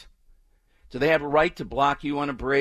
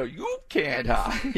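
slow, leaning hard on "you" in "you can't hide"; drawn-out taunt with the weight on "you" and a falling "hide"; taunting inflection; 7 semitones higher, much slower than usual. Shown as a demonstration. Host demonstrates the protester's exact inflection to co-host, slow taunting stress on "you". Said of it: "You gotta get the right inflection there".

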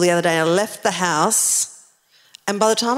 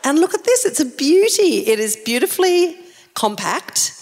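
higher-pitched, admiring sales pitch; gushing show-and-tell; 6 semitones higher than usual. Acted. Presenter showing the crowd a tiny gnome, gushing. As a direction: show off a prized little treasure.

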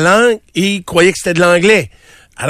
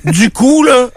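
mock France French; 8 semitones higher, 3 dB louder, slower than usual. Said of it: "si vous croisez des Français".

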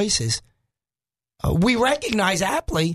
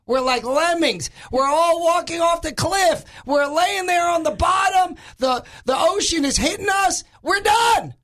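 mock doom rant; 10 semitones higher than usual. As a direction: whip yourself into a comic end-of-the-world frenzy. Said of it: "With that calm thought".

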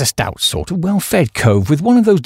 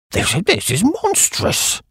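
slow, higher-pitched indignation without shouting; outraged splutter; 4 semitones higher, slower than usual. A narrator's reading. Old Duke accused of imposture, spluttering outrage. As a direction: draw it out, aghast and offended.

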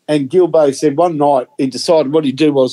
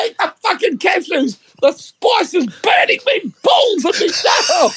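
much higher, laughing half-crying mock scream; retold pained scream. Gilbo screaming that the spice is burning him, hammed up. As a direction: howl through the burn while cracking up. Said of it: "Gilbo just starts screaming uncontrollably".

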